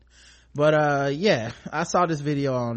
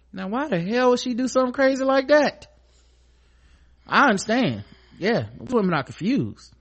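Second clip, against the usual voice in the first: sarcastic; 9 semitones higher, 5 dB louder than usual. Retold or imitated. Mocking the boyfriend's view.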